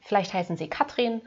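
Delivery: normal talking voice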